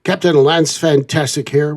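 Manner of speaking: usual speaking voice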